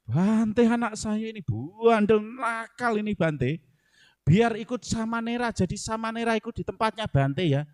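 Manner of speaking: a mother asking the monk to take her unruly son, pleading. Retold or imitated. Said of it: "ibu-ibu itu curhatnya ... ke bantenya juga".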